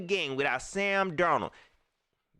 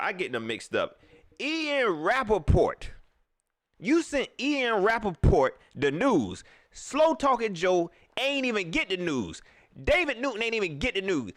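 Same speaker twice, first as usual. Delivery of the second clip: ordinary delivery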